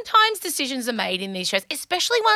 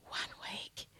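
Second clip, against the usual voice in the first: One week whispered, breathy and hushed; 23 dB quieter than usual. Shown as a demonstration.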